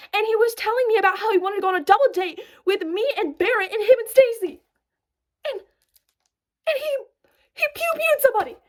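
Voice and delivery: high-pitched disbelief. Real, not acted. Recalling she washed dishes with him, high disbelief. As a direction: spill it out in stunned disbelief. Reliving the shocking discovery.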